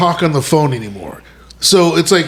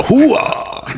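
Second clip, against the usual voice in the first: mimicked hoo-ah; 7 semitones higher than usual. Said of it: "Give him another one".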